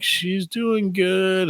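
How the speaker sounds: hammed-up scared politeness; mock nervous reply. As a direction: tiptoe through the answer. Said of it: "I'm like".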